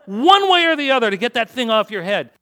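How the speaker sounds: high, loud, fed-up outburst; exasperated shout. Retold or imitated. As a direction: blurt it out in frustration. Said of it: "I'm sitting there going".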